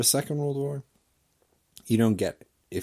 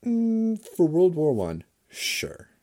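sarcastic agreement; 12 semitones higher, 9 dB louder, slower than usual. Retold or imitated. Mocking reply to German acquaintances.

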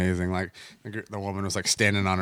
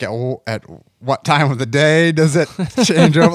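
laughing question; 8 semitones higher, 7 dB louder than usual. Retold or imitated. Asks about brothel hours.

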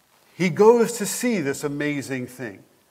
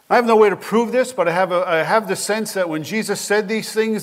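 normal talking voice

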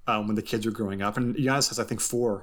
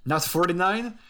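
a hint of a Greek accent, pitched higher; light Greek accent; 8 semitones higher, slower than usual. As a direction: tick off the wrong numbers in a light Greek accent. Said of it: "In his lovely Greek accent".

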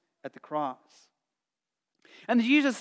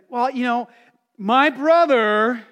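whiny complaint; 5 semitones higher, 9 dB louder, slower than usual. Retold or imitated. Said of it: "He's like".

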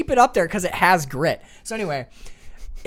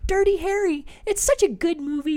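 put-on much higher, sweet old-lady voice; grandma voice; 12 semitones higher than usual. Grandson voicing his grandma at 3 AM, high old-lady voice. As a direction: play his grandma, gentle and apologetic.

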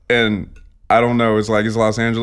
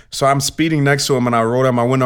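ordinary delivery

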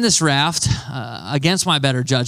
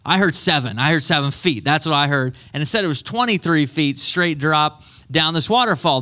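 ordinary delivery

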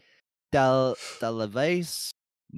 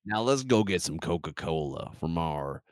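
put-on Georgian accent; 7 semitones lower than usual. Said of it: "my Georgian accent".

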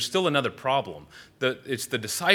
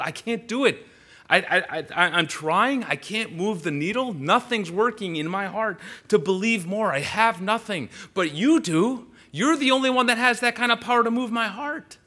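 impassioned confession; 10 semitones higher, 5 dB louder than usual. Acted. Preacher voices a believer's desperation.

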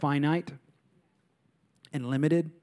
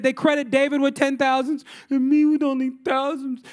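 Saul's whining complaint, higher and pitched up; whiny Saul; 9 semitones higher, 4 dB louder than usual. Retold or imitated. Preacher voicing jealous king Saul, whiny. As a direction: whine it like a sulking king. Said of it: "he's just whiny".

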